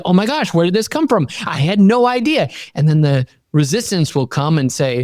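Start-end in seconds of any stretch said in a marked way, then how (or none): 0.1-2.5 high, fast, amazed; high delighted disbelief; amazed surprise. Retold or imitated. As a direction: gasp at the sudden luck. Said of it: "And you're like".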